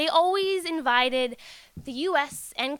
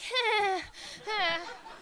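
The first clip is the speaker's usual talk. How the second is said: nervous laugh; 7 semitones higher, 5 dB quieter than usual. Real, not acted. Awkward moment with president.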